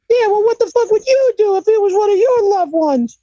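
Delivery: very high, offended squawk; indignant Cornette impression. Storyteller voices the manager snapping back in a very high, offended squawk. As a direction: snap back, deeply offended. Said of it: "Cornette's indignant reply".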